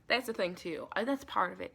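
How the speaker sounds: ordinary talk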